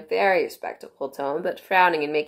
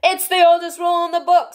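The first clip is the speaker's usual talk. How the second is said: high, loud pompous king; 12 semitones higher, 7 dB louder than usual. A narrator's reading. King voiced in reading.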